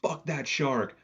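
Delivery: high, drawn-out cheering yell; re-enacted yell. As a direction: yell it like egging on a fighter. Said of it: "I fucking yelled".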